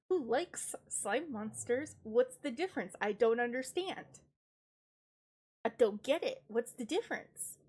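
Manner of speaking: ordinary talk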